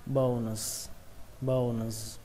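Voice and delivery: "Bones" as long O, n, then drawn-out final z; O plus N. Shown as a demonstration. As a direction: sound each part of "Bones": bâu, n, z. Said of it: "đó là âm O và âm N". (đọc "Bones" rõ âm O, âm N và âm cuối)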